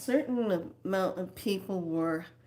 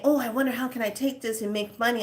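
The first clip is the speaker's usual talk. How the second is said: quick, higher-pitched mimicry of schemers; mimicking money-minded people; 4 semitones higher, much faster than usual. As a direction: rattle off their eager scheming. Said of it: "have this way of saying".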